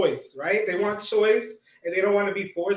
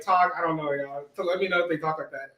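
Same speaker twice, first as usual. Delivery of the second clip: usual speaking voice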